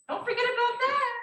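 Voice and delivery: high teasing nagging mom voice; needling mom. Retold or imitated. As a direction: nag like a needling mother. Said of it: "She was very good at needling".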